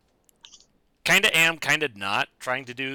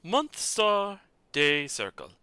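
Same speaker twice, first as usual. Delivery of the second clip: ordinary delivery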